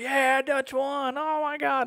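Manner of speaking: slow, high, excited crowd voice; crowd cheering. Retold or imitated. Wrestler voicing fans cheering his title win, slow and high. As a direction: stretch it out like fans celebrating.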